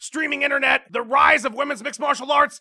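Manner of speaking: ordinary delivery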